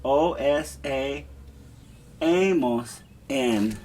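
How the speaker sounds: endings spelled out slowly; slow drill. Shown as a demonstration. Teacher spelling the E R endings slowly. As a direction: chant it slowly, letter by letter. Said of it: "The only difference for E R verbs is".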